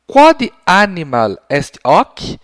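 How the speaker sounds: slow, carefully spaced Latin question; teacher modelling a question, slow and loud; slow clear question. Shown as a demonstration. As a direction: model the question slowly for a child. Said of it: "com a seguinte pergunta".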